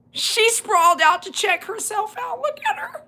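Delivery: high squeal breaking into laughter; cracking up. Real, not acted. Owner watching her dog admire itself, cracking up. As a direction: voice climbs until laughter takes it.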